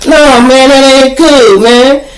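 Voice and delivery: high, firm no; stubborn refusal. Retold or imitated. Dealer refusing in argument.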